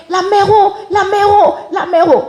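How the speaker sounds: high calling cry for mother. Retold or imitated. Calling to her mother who cannot hear, high call. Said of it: "Et je criais".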